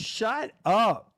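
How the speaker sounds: hammed-up wife telling him off; imitating wife. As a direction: snap it like the annoyed wife. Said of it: "I heard my wife in the background".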